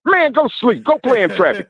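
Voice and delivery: high, scoffing brush-off; dismissive taunt. Real, not acted. Caller brushing off Southern fans, scoffing. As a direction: wave them away with contempt.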